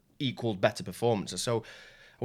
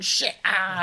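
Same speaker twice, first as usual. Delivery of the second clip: pained yelp; 10 semitones higher, 7 dB louder than usual. Retold or imitated. Foot breaking in a footlock.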